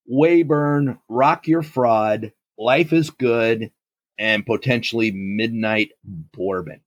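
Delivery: ordinary talk, nothing marked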